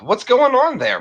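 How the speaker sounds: high, loud hello to chat; excited greeting. Real, not acted. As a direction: throw the welcome up to the rafters.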